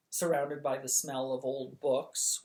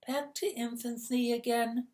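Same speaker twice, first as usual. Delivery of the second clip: put-on a sighed, small-boy lament; sighing; 8 semitones higher than usual. Twinkle to Charmaine, sighing over childhood. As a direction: sigh it out, weary of being little. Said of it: "he said, sighing".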